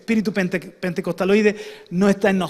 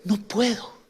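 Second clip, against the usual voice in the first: tearful despair; 5 dB quieter, slower than usual. Retold or imitated. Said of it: "Se puso a llorar".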